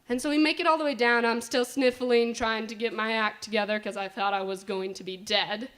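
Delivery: usual speaking voice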